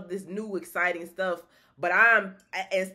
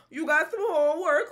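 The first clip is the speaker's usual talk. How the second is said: teasing; 10 semitones higher, 7 dB louder than usual. Real, not acted. Teasing viewers with homework.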